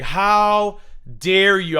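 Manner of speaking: very slow, loud, high mock gasp of offense; hammed-up scandalized lady; pearl-clutching outrage. Pretending to be scandalized by the insult, slow loud mock outrage. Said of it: "I would have clutched my pearls".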